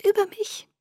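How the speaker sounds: tiny high-pitched squeak. A narrator's reading. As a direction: squeak the question, small and soft. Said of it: "piepste ich". (leise und hoch gepiepst)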